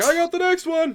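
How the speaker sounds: very high, loud call; excited shout from the crowd; rowdy patron. Acted. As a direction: holler an offer over the bar noise.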